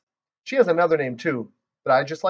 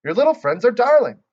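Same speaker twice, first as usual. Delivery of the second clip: shocked grandma; 11 semitones higher, 5 dB louder than usual. Acted. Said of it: "very shocked".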